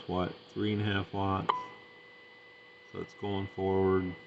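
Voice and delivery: ordinary talk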